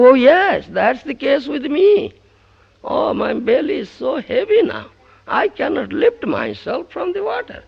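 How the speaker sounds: high, loud, stuffed complaint; groaning disciple. Acted. Disciple telling the guru his belly is heavy. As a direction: sound too full to move.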